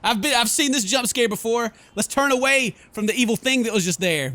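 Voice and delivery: ordinary talk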